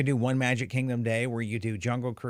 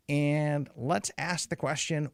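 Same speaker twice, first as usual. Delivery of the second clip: ordinary talk, nothing marked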